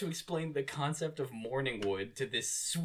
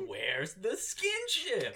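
drawn-out, higher mock shout; mock outrage; 5 semitones higher, much slower than usual. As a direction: bellow it slowly like a fist hitting the table. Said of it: "He slams his fist on the table".

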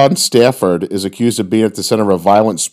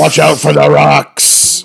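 mock lighthouse warning; 4 semitones higher, slower than usual. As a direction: call it out like a lighthouse keeper.

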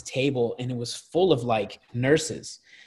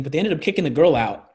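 ordinary delivery

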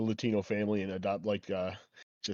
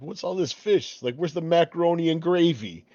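lightly imitated complaining mobster, higher; a higher-pitched mock grumble about dinner; Paulie; 7 semitones higher, 4 dB louder than usual. Sopranos gangster complaining about foreign food, lightly imitated indignation. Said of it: "it's Paulie sitting there. He's like".

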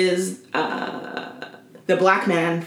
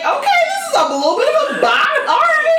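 high delighted okay, this is a little bit of a bop; mock delighted; 3 dB louder than usual. Retelling hearing a song start, high delighted squeal. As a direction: squeal it as a happy surprise. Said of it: "I was like".

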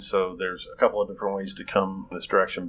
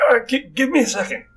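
much higher, timid voice; high nervous voice; 5 dB louder than usual. Acted. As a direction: squeak it out nervously. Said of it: "he kinda just says".

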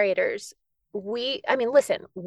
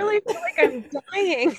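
overwhelmed; 13 semitones higher than usual. Real, not acted. Overwhelmed after tribute.

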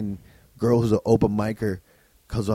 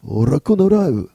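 put-on higher, louder, slow broken-English Japanese accent; mock Japanese accent; 5 semitones higher, 6 dB louder, much slower than usual. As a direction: read the raccoon diary in a hammed-up Japanese accent. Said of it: "when you do the accent".

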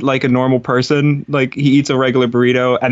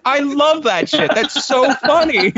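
high, gleeful squeal through laughter; helpless giggly enthusiasm; laughing delight; 13 semitones higher than usual. Real, not acted. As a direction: gush it, cracking up, voice way up high. Cracking up over dog videos.

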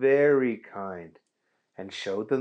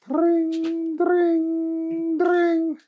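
high sustained ring sounds; phone ringing; 4 dB louder, much slower than usual. Acted. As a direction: ring out like an old phone, drawn long.

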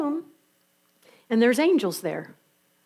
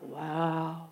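awed wow; 6 semitones lower, 11 dB quieter than usual. Acted. Disciples amazed.

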